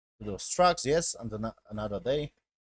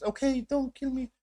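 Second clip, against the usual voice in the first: much higher voice, begging lightly; mock pleading; 12 semitones higher than usual. Captive begging his attacker, mock pleading. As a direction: imitate a captive pleading for life.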